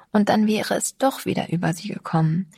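plain reading voice

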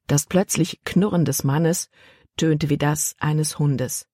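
plain reading voice

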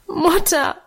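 high, crying call to mother; tearful cry out. A narrator's reading. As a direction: call out as a girl bursting into tears. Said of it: "rief Minchen und fiel ihr weinend". (weinerlich rufendes Mädchen)

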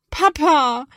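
high, loud, plaintive call for dad. Acted. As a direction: call out, close to tears. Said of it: "klingt es schon etwas kläglicher".

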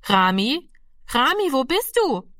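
put-on high, lilting call for a child. A narrator's reading. Mother calls the boy in from the yard, high searching call. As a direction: call out searchingly, pitch leaping up. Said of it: "Frau Lenzens Stimme, die nach ihm rief".

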